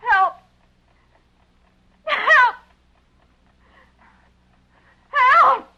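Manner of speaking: building from a call to a high, loud scream; screaming for help. Acted.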